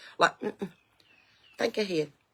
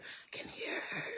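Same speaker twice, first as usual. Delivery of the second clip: slow ghostly whisper; eerie whisper; 17 dB quieter, slower than usual. Real, not acted. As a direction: breathe it like a ghost story. Spooky aside about dead woman.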